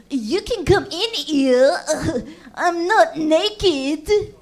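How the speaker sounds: slow, awkward mock male voice; guy calling from bedroom. Friend calling from his bedroom, slow sheepish mock voice. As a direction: drawl it out sheepishly, laughing. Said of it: "he's like".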